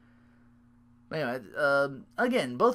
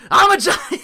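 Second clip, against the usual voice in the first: high, loud boast cracking into a laugh; giant voice, laughing; 13 dB louder than usual. Pretend. Breaking character as the giant, high loud laughing boast. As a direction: brag like a giant, then laugh. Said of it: "this theme got away from me".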